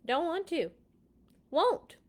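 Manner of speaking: sulky king digging in his heels; stubborn refusal. Acted. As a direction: Pharaoh refusing Moses like a stubborn child.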